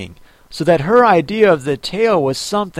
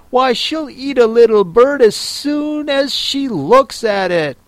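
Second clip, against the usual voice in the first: put-on higher, eager little girl; 6 semitones higher than usual. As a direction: bubble over about her pet cat. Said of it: "Alice replied eagerly".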